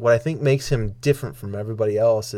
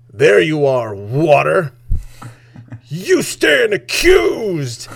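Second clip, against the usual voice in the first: builds from a firm address to a high, slow shouted accusation; mock accusing yell; 4 semitones higher, 4 dB louder, much slower than usual. Comedian accusing his water bottle on stage, mock furious yell. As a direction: play a comedian putting his water on trial, rising to a yell. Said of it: "yelling at his water bottle".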